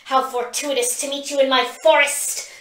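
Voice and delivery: put-on gloating young dragon; hissing dragon. A narrator's reading. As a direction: hiss it with cold delight. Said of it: "the drakeling hisses".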